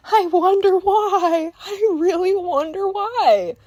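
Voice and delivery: very high, loud, slow sing-song sarcasm; hammed-up, high-pitched sarcastic musing; mock wondering. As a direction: draw it out in exaggerated fake puzzlement. Sarcastic reaction to customer's reputation.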